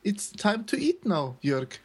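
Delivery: high, slow playful telling-off in English; mock stern scolding. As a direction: scold him like a strict parent, jokingly.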